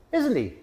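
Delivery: "isn't he" pitched high, then dropping; question tag intonation. Shown as a demonstration. Teacher showing question tags, high-pitched "isn't he". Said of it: "our voice goes up a bit".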